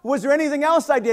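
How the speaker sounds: loud and high, a hammed-up indignant complaint; mock outrage at the professor; loud retold protest. Student to professor, hammed-up loud complaint. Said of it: "So I said".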